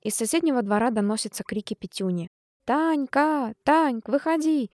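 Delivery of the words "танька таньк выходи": desperate shouts. A narrator's reading. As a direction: yell for her from the yard. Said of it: "отчаянные крики".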